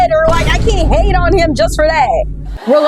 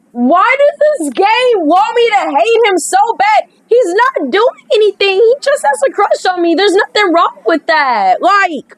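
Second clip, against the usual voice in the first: higher, animated and indignant; a pitched-up defence of the poor guy; excited rant; 7 semitones higher than usual. Real, not acted. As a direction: protest it, all worked up. Player defending the game character.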